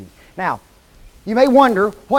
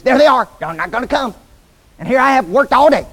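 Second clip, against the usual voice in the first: loud, raised-pitch mock complaint; hammed-up indignant griping; exasperated host; 5 semitones higher, 5 dB louder, faster than usual. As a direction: play the put-upon host erupting. Exasperated host acted as guests skip.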